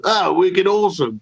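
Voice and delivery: put-on light comic Boston accent. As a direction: play a Bostonian cartoon pelican. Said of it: "If they had done one in Boston".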